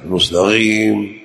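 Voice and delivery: المستقيم slow, with gh for ق; wrong ghayn in المستقيم; qaf as ghayn. Shown as a demonstration. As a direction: say al-mustagheem for المستقيم. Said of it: "هذا باطل".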